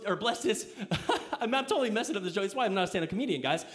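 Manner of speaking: ordinary talk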